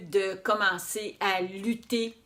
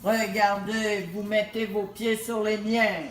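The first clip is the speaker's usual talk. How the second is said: robot voice. Acted. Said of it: "Je suis un robot".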